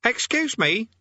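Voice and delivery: a brat piping up; cheeky Eric. Acted. Eric's voice.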